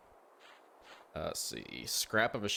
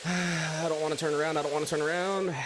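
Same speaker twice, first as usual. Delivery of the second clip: scared; 7 semitones higher than usual. Real, not acted. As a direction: dread of what's behind. Monster behind, scared.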